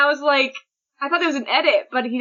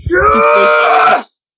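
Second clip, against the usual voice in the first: high, loud, long-held yell; roaring cheer; 11 semitones higher, 7 dB louder than usual. Acted. Co-host joining in on Machia's roar, long loud yell. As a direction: stretch it into one big mock roar.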